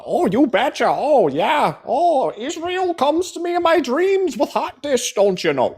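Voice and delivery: high-pitched, drawn-out mock Midwestern accent; exaggerated Michigan-Minnesota caricature, pitched sky-high; fake Michigan accent. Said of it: "accent that you have".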